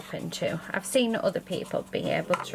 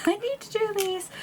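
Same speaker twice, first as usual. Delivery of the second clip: laughing; 13 semitones higher than usual. Real, not acted. Laughing at own impatience.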